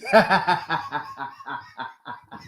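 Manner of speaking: laughing hard at own joke. Real, not acted. Laughing at his own mocking song, hearty laugh. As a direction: break into laughter.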